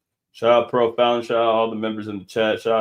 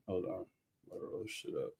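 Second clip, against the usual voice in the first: whispered aside; 6 semitones lower, 20 dB quieter than usual. Real, not acted. Muttering aside off mic.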